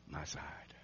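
hushed, intimate whisper. Acted. Jesus inviting Thomas to touch his side, in a whisper. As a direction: breathe it out softly to him.

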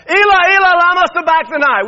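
very high, full-voiced shout to God; loud cry. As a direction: cry it out at the top of your voice. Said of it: "Jesus cried out with a loud voice".

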